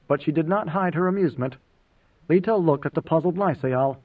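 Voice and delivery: ordinary delivery, nothing marked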